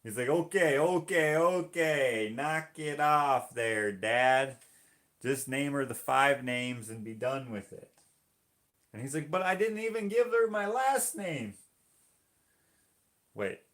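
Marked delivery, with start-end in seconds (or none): 0.3-7.9 loud, higher mock-exasperated interruption; exasperated priest. Priest stopping drunk dad at christening, loud exasperation. 9.3-11.5 high, loud mock whine of protest; protesting drunk dad. Drunk dad at christening protesting to priest, high mock indignation.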